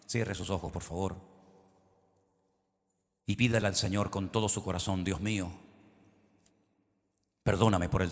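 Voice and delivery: hushed pastoral prayer; leading prayer. Acted. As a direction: guide the congregation into repentance. Leads a prayer.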